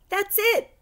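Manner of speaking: high, bright thanks; delighted with the drawing; girl thanking a friend for his drawing; pleased. Acted. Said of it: "she said".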